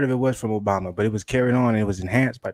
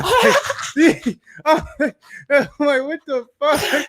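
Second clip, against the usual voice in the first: laughing disbelief; 13 semitones higher, much slower than usual. Real, not acted. Laughing while retelling reaction.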